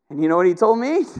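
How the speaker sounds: ordinary delivery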